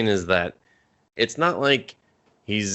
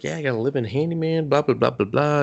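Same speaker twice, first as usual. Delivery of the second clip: lightly voiced chatty mom, slightly higher; gossipy mom; 5 semitones higher than usual. Mom tells Brewster everything about the house, chattering. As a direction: rattle it off like a gossiping neighbor. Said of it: "She's like".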